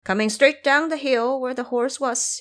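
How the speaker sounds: ordinary delivery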